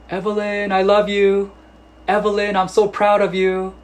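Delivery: high, loud shouting of affection from far off; a father bellowing praise at his daughter; proud dad yelling across the park. Retold or imitated. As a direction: call out proudly through cupped hands. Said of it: "screaming affection".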